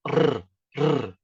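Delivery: "r, r" as short tongue-tip trills; trilled R. Shown as a demonstration. Teacher showing the Spanish-like sound, two separate rolled r's. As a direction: roll each isolated "r" like a Spanish RR. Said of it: "Que é o trill".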